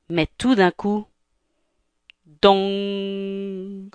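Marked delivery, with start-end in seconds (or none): 2.3-3.9 long, droning bell "dong"; imitated clock chime. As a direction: strike it and let it ring out. Clock strikes midnight in the tale. (imitation d'une cloche qui résonne)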